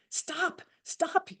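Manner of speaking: a grieving widow yells at the killer's wife to stop; retold angry yell. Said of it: "she just yelled at her and said".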